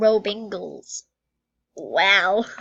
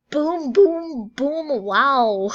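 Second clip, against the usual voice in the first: mind-blown booms; 4 semitones higher, 5 dB louder, much slower than usual. Retold or imitated. Mind blown by being noticed.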